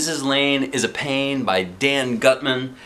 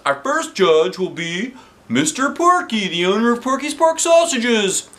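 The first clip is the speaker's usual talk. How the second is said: high, bright showman's welcome; announcer voice; 9 semitones higher, 3 dB louder than usual. Acted. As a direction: announce it to the crowd. Principal announcing on stage.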